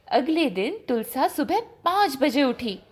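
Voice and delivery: usual speaking voice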